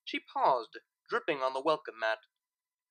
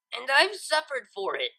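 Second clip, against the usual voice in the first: high, louder whine; whiny protest; 8 semitones higher, 5 dB louder than usual. A narrator's reading. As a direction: protest like a martyr. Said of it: "Lyra protested".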